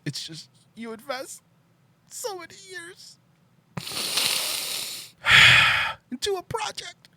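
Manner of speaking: hammed-up crying voice; mock emotional. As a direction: fake choking up over the project. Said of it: "I'm getting emotional".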